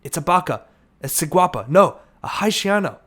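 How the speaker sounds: mounting shouts of fear; frightened cries. Acted. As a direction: band members crying out in alarm. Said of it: "Cries of".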